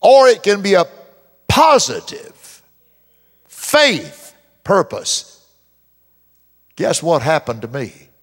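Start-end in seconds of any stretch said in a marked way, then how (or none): 1.5-5.4 positive drawn out high and slow; preacher stretching each word; drawn-out emphasis. Acted. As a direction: stretch out positive faith purpose.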